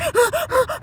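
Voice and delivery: sharp high gasp of shock. Retold or imitated. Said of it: "'cause I'm still in shock".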